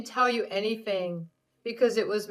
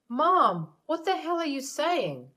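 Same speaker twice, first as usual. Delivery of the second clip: higher, shocked son's outburst; shocked protest; 8 semitones higher, 4 dB louder than usual. Acted. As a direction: cut her off, stunned. Ace shocked at his mother.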